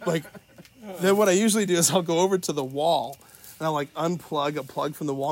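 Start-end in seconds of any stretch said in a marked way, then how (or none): none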